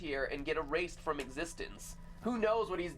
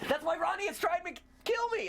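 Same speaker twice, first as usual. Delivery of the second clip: high, startled mock panic; mock alarm; 10 semitones higher than usual. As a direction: yelp it like you just realized. Mock outrage at teammate's line.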